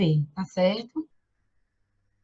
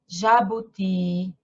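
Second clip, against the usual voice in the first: slow syllable demonstration; 4 semitones lower, much slower than usual. Shown as a demonstration. Teacher shows word slowly.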